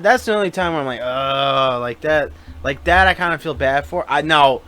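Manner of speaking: ordinary talk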